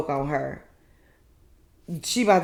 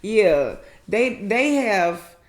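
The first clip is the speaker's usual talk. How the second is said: louder, higher imitation of a friend; Letitia impression; 5 semitones higher, 6 dB louder, slower than usual. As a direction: put on her voice, louder and raised. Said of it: "In my Letitia voice".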